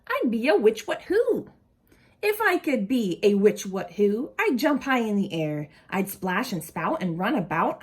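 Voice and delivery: lively storybook read-aloud voice; animated picture-book reading; reading to children. Acted. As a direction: read it to a group of kids with expression.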